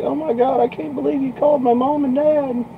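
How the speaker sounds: very high, whiny imitation of a crying girl; mock tearful. Girl upset her parents were called, imitated tearful wail. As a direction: mimic her on the verge of tears, way up high. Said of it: "almost on the verge of tears, crying".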